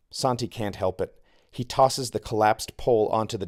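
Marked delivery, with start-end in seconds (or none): none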